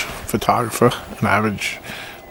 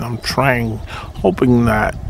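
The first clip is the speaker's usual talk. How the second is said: normal talking voice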